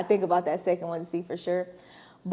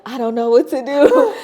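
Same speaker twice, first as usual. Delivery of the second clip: mock panicked sister; 4 semitones higher, 10 dB louder than usual. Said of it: "I could just hear her whispering".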